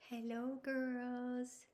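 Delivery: high, soft and slow puppet greeting; drawn-out gentle puppet voice; slow puppet hello. Pretend. Puppet Baxter greeting the girls, slow and soft.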